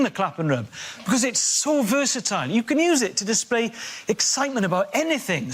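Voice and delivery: normal talking voice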